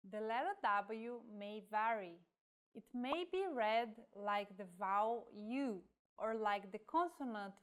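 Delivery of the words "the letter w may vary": ordinary delivery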